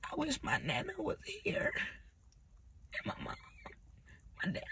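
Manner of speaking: whispered, broken with grief; a real grieving whisper; tearful whisper. Real, not acted. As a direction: barely voice the wish. Grieving, crying on camera.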